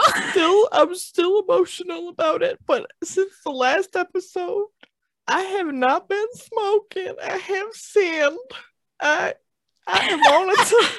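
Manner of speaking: high wavering mock crying; hammed-up emotional confession; mock tearful confession.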